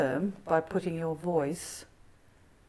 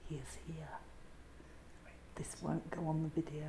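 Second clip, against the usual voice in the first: whispering; 2 semitones lower, 15 dB quieter than usual. Real, not acted. Whispering during the ghost hunt.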